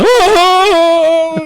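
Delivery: high loud mock sob wail. Drunk breaking down at the cop, mock wail.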